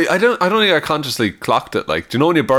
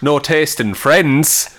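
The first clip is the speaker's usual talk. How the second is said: usual speaking voice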